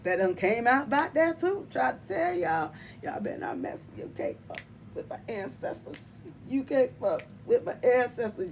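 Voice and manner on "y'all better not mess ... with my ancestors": whispered warning. Pretend. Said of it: "mock warning, whispered".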